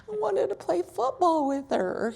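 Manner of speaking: put-on high little-boy explanation; kid voice. Mother imitating her son's innocent excuse, high-pitched. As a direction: speak like an innocent toddler. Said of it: "he said".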